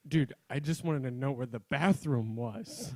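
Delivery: slow, loud mock exasperation; exasperated quote. Lost listener answering long directions, loud mock exasperation. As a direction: spell it out loudly, as if worn down. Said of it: "You're like, you're like".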